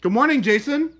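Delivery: high, loud put-on hello; loud mock greeting. Coworker teasing the boss with a morning hello, loud. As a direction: call out an exaggerated morning greeting.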